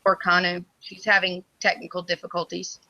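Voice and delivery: ordinary delivery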